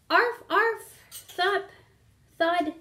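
dog calling out. A narrator's reading. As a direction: bark it out like an eager dog. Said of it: "called Pongo".

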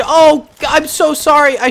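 loud apology. Real, not acted. Apologizing loudly after killing teammates.

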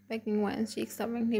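usual speaking voice

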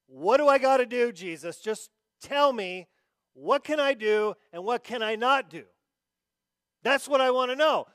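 loud, high, insistent pleading; hammed-up rule-seeker pressing Jesus; demanding the law. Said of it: "they just wanted to know".

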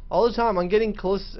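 usual speaking voice

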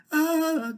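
high sharp gasp of pain. Acted. Dryad gasping as her tree is cut. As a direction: gasp as if struck. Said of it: "gasped the dryad".

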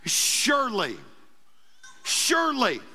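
"Surely" set apart by pauses, with a falling close; emphatic Surely. Retold or imitated. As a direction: say "Surely" as a firm, standalone declaration. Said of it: "this emphatic word called surely".

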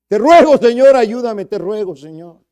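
high, loud begging prayer; desperate clamor that fades at the end; loud pleading cry. Acted. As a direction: cry out to God for help. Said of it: "debe haber sido un clamor a Dios".

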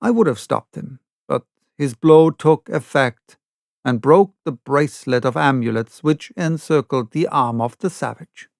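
usual speaking voice

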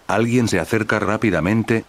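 plain reading voice

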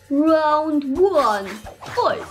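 slow booming announcer. Retold or imitated. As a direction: draw it out like a fight announcer. Imitating the game announcer.

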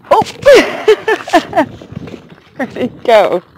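ordinary delivery

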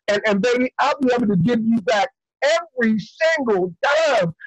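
usual speaking voice

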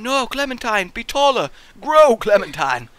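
loud, high commanding call; bossing the character around, half joking; mock command. Gamer shouting at Clementine to grow taller.

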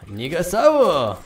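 slow fake Japanese word; mock Japanese-sounding name, drawn out. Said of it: "Pardon my fake Japanese".

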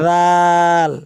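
a voiced th in রাল, tongue at the teeth; জিহ্বার আগায় রাল; tongue-tip zal. Shown as a demonstration. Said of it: "এই হরফটা উচ্চারণ করতে হবে".

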